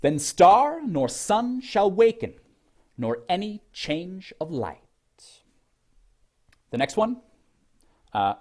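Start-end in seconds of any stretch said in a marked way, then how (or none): 0.0-4.8 verse with STAR, SUN and WAKEN punched high; exaggerated metrical verse reading, stressed syllables high and drawn out; overemphasized stresses. Shown as a demonstration. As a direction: read slowly in iambic beat, leaning hard on "star" and "sun". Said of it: "I'll overemphasize".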